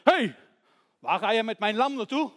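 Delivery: loud shout from an angry man; angry challenge. Acted. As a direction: holler accusingly to stop the child.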